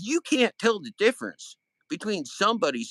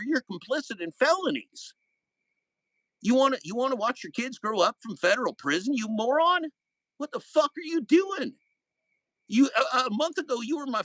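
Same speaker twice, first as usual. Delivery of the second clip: rising, much higher furious yelling; angry outburst; 7 semitones higher than usual. Real, not acted. To the former friend who spread lies, angry high yell. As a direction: lay into him, voice climbing high.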